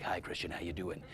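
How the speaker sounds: low, soft, self-admiring hello; smug voice-in-love greeting. Retold or imitated. Actor in love with his voice, greeting the casting director, low and soft. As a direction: purr the greeting like you love your own voice. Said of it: "they say hi to me like this".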